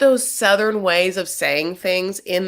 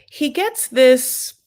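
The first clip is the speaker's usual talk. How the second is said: ordinary delivery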